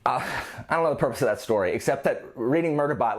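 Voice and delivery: usual speaking voice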